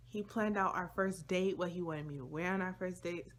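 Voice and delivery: ordinary talk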